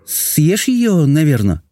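high, timid suggestion, dropping at the end; small, hesitant high voice; timid princess. A narrator's reading. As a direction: venture it meekly in a high princess voice. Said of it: "робко предположила принцесса".

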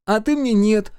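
put-on high, loud little-boy voice; simple-hearted smiling boy; guileless child. A narrator's reading. Микки answers sister, smiling guilelessly. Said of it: "простодушно улыбаясь".

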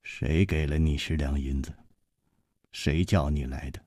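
low, soft stern questioning; frowning question. Acted. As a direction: press him quietly, brow knit. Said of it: "皱着眉问".

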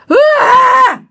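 piercing high scream; sudden scream. Acted. Aunt-in-law shrieking as the demon takes her, scream. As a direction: shriek out of nowhere, possessed. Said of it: "Mợ ba la lên vậy đó các bạn".

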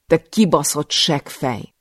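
loud, slow furious curse; furious insult. Acted. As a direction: hurl the insult at him. She swears at the master. (dühös, lassú szitok)

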